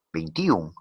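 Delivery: "veintiún" as vein-TÍ-un, stress moved to the i; wrong stress. Shown as a demonstration. Teacher repeating the unlikely pronunciation of "veintiún", wrongly stressed i. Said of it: "No creo que pronuncien".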